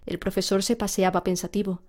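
ordinary delivery, nothing marked

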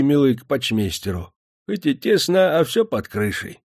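put-on old prospector. A narrator's reading. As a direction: mumble like a toothless old man. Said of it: "прошамкал старик".

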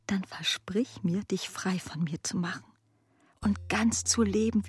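ordinary delivery

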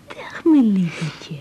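put-on slow breathy greeting to a baby; tender baby talk. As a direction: coo it to the little one. Talking to baby. (langzaam en teder tegen de baby)

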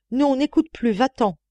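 understated inner cry; urgent cry. A narrator's reading. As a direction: urge her to flee, short of shouting. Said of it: "ce cri qui était sur mes lèvres".

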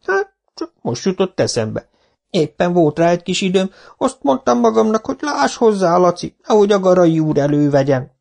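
high, mock-innocent old villager; innocent old man. Old Zsibora explaining to the foreman, mock-innocent. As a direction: play dumb, sweetly.

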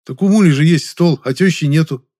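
high, loud insinuating hint; sly, underhand voice. A narrator's reading. Жасминов volunteers neighbour's table, slyly scheming. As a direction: slip in a crafty suggestion. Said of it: "своим подлым коварным голосом".

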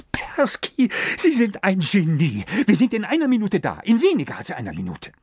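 overjoyed thanks to the magician, tumbling fast; excited. Acted. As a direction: blurt out delighted relief, fast. Thrilled at the magician's news.

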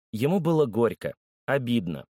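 usual speaking voice

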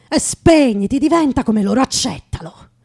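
loud, high, pushing command; the voice inside shouting to give up; harsh inner voice yelling surrender; urging inner voice. Acted. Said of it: "Qualcosa mi diceva".

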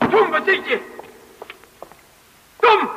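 roepen om Tom; calling out. Acted. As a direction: hoog en luid roepend, zoekend. Zoekt Tom in het gebouw. (zoekt Tom, roept hoog en hard naar hem)

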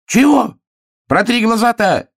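loud, very high squawk; high-pitched outraged outburst; indignant hare. A narrator's reading. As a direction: protest shrilly, affronted. Hare protests, bear ignores him.

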